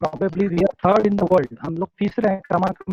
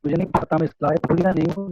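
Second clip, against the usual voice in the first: ordinary talk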